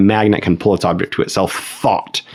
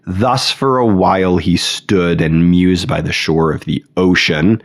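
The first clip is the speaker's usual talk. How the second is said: "Thus for a while he stood and mused by the shore of the ocean" read slowly, each beat of the six stresses marked; stressed scansion reading. Shown as a demonstration. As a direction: tap out the hexameter beats slowly. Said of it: "six stressed syllables".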